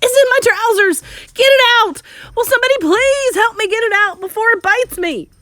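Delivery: high, loud comic shrieking; panicked shouting. A narrator's reading. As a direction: beg frantically for rescue at full voice. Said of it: "stupid cook still shouting".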